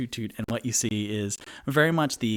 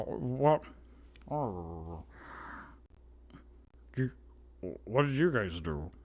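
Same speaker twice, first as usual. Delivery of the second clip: Toot Toot's voice; 4 dB quieter than usual. Acted. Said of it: "dang it, it's not good. I tried".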